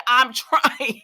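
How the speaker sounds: a laugh breaking into the words; amused retelling. Retold or imitated. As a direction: laugh through the line. Retold character laughing mid-line.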